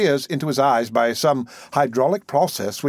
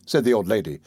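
ordinary delivery, nothing marked

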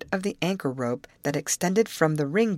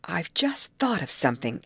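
ordinary delivery

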